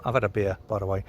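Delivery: ordinary talk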